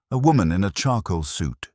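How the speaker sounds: normal talking voice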